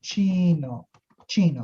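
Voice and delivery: Chino with a crisp ch as in chair; Spanish pronunciation. Shown as a demonstration. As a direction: say Chino with a clear ch sound and a full o. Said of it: "isso é a pronúncia em espanhol".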